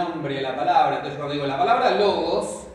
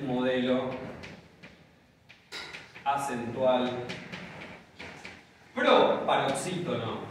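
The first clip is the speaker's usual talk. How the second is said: words spaced out slowly; teacher spelling out the new term for notes; slow dictation; 2 semitones lower, slower than usual. Shown as a demonstration.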